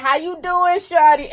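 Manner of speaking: high, bouncy reply; playful greeting. Retold or imitated. Answering her coworker's greeting, high playful reply. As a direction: toss it back high and light. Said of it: "and I'll be like".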